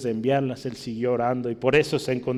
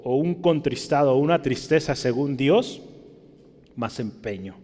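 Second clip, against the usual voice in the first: ordinary delivery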